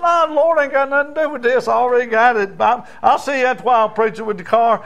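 high, loud brush-off, rushing at the end; defiant boy. Acted. Boy cockily brushing off the preacher, high and fast. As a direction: shrug off the Lord entirely.

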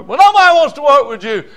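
loud high hammed-up boss voice; mock boss complaint. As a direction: shout it like the annoyed boss. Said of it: "boss quoted".

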